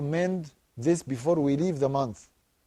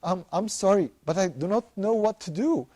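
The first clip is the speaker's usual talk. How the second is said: put-on higher pleading child, rising toward desperate; pleading little girl; 5 semitones higher than usual. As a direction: beg Dad for help like a crying six-year-old. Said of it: "And she said".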